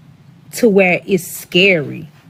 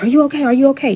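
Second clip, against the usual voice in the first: high, quick worried check through the door; concerned customer; 6 semitones higher, much faster than usual. Retold or imitated. Customer banging on the bathroom door to check on her, worried. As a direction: imitate an alarmed customer calling in.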